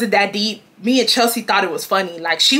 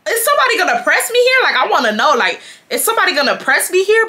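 mock challenge; 7 semitones higher, faster than usual. Acted challenge to onlookers.

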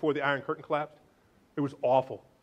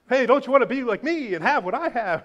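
mock cheerful boast; 7 semitones higher, 5 dB louder than usual. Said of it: "we think".

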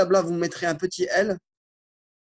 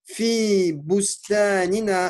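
slow, deliberate dictation of Arabic words; careful teacher dictation with pauses between words; 3 semitones higher, much slower than usual. Shown as a demonstration. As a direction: dictate each word slowly so students can write it. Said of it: "l'autodictée que je vous lis".

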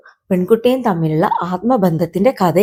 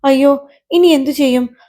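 high-pitched acted wail; tearful dismay; 6 semitones higher than usual. Lost kite with a dead phone line, tearful dismay. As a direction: lament helplessly, close to tears. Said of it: "അവന് കരച്ചിലടക്കാനായില്ല".

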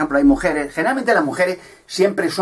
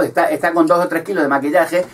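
ordinary talk, nothing marked

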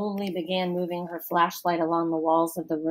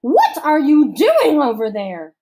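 slow, loud, higher stern matron; stern camp counselor; 7 semitones higher, 8 dB louder than usual. A narrator's reading. Missus Periwinkle interrogating Allison, stern, slow and loud. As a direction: lecture slowly like a suspicious matron.

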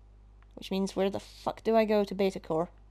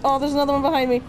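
loud, higher-pitched scared babble; real panic; 5 semitones higher, 4 dB louder than usual. Real, not acted. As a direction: freak out, repeating yourself in raised-voice panic. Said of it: "Oh god, I'm freaking out".

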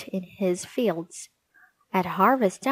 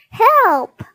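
very high, thin pleading cry for help; a tiny fairy trapped in a web calls out, very high; 14 semitones higher, 9 dB louder than usual. Acted. Fairy stuck in a spider's web begs for help, very high and pleading.

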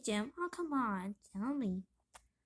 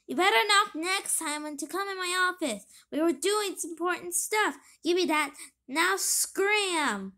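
annoyed; 4 semitones higher, 5 dB louder than usual. Acted. Annoyed chief scolding.

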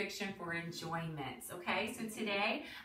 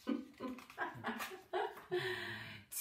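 soft, breathy giggle; little laugh to oneself; amused chuckle; 10 semitones higher, 7 dB quieter than usual. Real, not acted. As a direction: chuckle quietly at the silly rhyme. Laughs at the book.

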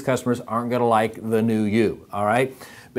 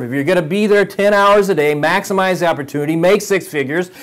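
high, loud motivational pep talk; rousing sign-off; 8 semitones higher, 6 dB louder than usual. Real, not acted. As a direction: fire them up, voice raised and pitched high.

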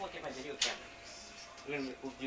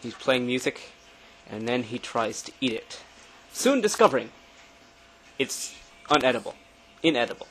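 nature documentary; 3 semitones lower, 12 dB louder than usual. Pretend. Narrates friend like wildlife film.

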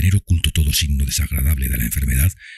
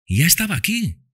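put-on high girl voice, tearful; Topsy answers Ofelia through tears, high girl voice. A narrator's reading. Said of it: "dijo Topsy".

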